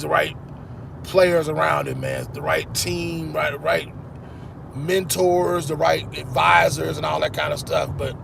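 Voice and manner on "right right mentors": ordinary delivery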